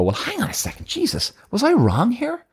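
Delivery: sudden high-pitched gasp of doubt at the end; mock self-doubt. Partner owning up after a fight, high mock dismay. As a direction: squeak out the realisation you might be wrong.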